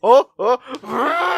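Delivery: hammed-up startled oh oh; mock the babysitter's over-the-top gasps; mock shocked babysitter. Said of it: "looks like she has a seizure".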